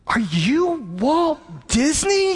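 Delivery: high, drawn-out amazed question; awestruck little girl. Retold or imitated. Little girl asks her dad at home, high-pitched awe. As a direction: imitate a child bursting with wonder. Awed daughter confronts her father.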